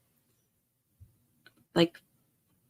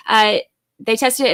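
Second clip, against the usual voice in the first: ordinary delivery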